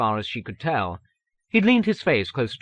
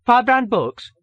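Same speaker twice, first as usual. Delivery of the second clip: high, louder receptionist's greeting; receptionist announcing; 13 semitones higher, 6 dB louder than usual. A narrator's reading. Receptionist announcing the company to a caller, high and loud. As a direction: answer the phone as a high-voiced receptionist. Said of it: "a receptionist's voice announced".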